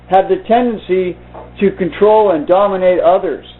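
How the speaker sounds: steady audiobook-style reading of the novel; story narration. A narrator's reading. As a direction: read it through to listeners, evenly. Reads novel aloud to viewers.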